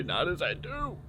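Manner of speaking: voice cracking. Real, not acted. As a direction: let the voice crack up high. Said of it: "my voice cracked".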